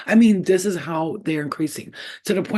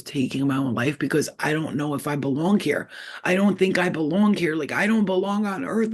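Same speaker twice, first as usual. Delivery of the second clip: ordinary talk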